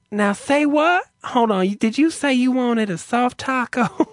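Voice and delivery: high, baffled drive-thru clerk impression; mock an effeminate man asking, pitch way up; feminine male clerk. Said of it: "He's very feminine sounding".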